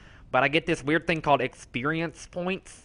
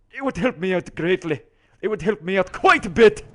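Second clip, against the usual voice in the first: put-on oddly accented, eager character voice; goofy put-on voice with swooping pitch; put-on sidekick character; 2 semitones higher than usual. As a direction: plead as a quirky sidekick. Second character voice asks.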